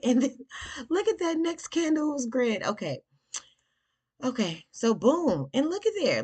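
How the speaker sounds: excited. Real, not acted. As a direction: cheer the price move on like a win. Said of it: "I get excited".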